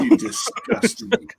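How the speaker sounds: high, slow, sneering cat; a cat looking down its nose; disdainful cat voice. Pretend. Co-host voices a cat sneering at its human. Voicing the cat's look.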